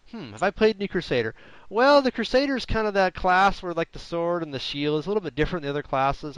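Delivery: high-pitched, musing guest impression; thinking aloud. Early guest restating the host's question before answering, mock thinking aloud. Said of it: "kind of like thinking aloud".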